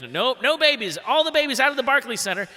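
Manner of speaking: security guard yelling at the babies to get out; mock security yell. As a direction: shoo them out like a guard. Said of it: "starts yelling at us".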